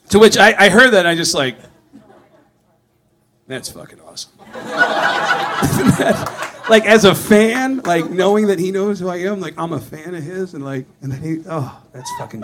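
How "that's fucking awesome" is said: awed whisper. Real, not acted. Comic hears Gene's request and whispers in delight. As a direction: breathe it out in delight.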